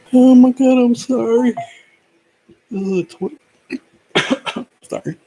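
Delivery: high, strained, choking up; gasping out the words while choking. Real, not acted. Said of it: "I'm choking up over here".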